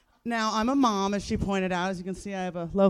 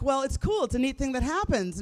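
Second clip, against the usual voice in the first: higher-pitched mock-breezy selling; upbeat reassurance; 6 semitones higher than usual. Mother reassures doubting daughter about tooth fairy, upbeat. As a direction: play a parent brightly smoothing it over.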